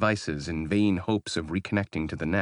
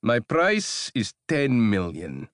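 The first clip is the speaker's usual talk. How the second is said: higher, slow and louder; slow smug demand; 5 semitones higher, 4 dB louder, slower than usual. Acted. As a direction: savor every word of the price. Baylor names his price.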